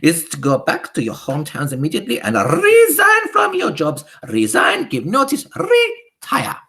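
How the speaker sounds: high, loud witch voice with a foreign lilt; high imperious witch decree; Grand High Witch. Acted. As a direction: command the witches like a tyrant queen.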